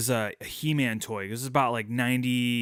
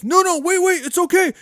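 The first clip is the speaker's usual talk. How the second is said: much higher and louder, hurried pleading; frantic reassuring dad; 9 dB louder than usual. Retold or imitated. Dad chasing his scared son, loud frantic reassurance. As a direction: call after him, trying to calm him.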